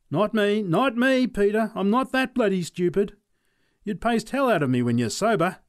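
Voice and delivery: high, loud panicky refusal; scared neighbour. Acted. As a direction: back out in a fluster. Charlie Robbins refuses to fight.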